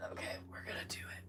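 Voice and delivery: fast, quiet whispered pep talk. Real, not acted. Potter psyching herself up before the work, whispering. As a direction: whisper it to yourself, quick.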